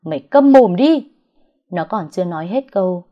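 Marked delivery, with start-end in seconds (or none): 0.0-1.1 loud, high scream to shut up; furious shout. A narrator's reading. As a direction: yell it over her. Friend silences her. (hét lớn bắt im mồm)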